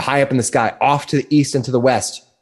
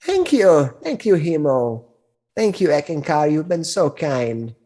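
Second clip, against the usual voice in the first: put-on high, slow sweet old crone; a kindly old hag selling her wares; 3 semitones higher, slower than usual.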